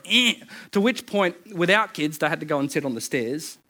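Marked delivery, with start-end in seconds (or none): 0.0-0.3 short sulky grunt, mimicking a child; child's grunt. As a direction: grunt like a cross five-year-old. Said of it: "descended into half an hour of".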